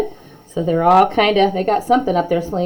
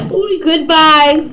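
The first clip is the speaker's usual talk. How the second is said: mild call, higher; calling goodbye; 7 semitones higher than usual. Acted. Students leaving the classroom, mild calling out. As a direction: call it out as you rush off. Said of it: "the students called".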